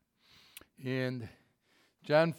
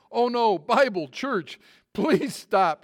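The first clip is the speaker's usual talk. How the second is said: high, loud string of panicked guesses; desperate shout; 6 semitones higher, 9 dB louder, much slower than usual. Retold or imitated. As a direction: shout any holy word to stop the mule. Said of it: "shouted the man".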